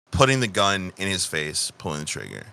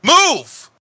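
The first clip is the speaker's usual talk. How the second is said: high sudden bark of a command; sharp shout; 6 dB louder than usual. Acted. Assassin shouting at the fleeing union guy, high and loud. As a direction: snap it out to clear the way. Tabaxi barks at fleeing man.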